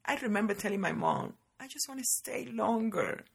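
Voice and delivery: normal talking voice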